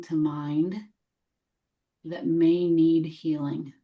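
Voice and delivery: measured meditation voice, long silences between phrases; gently invite, leaving room after each phrase; guided meditation. Real, not acted. Guide welcoming what needs healing, gentle meditation voice.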